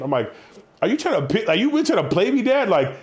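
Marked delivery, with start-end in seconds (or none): 0.8-2.7 indignant protest. Acted. Acted confronting his dad.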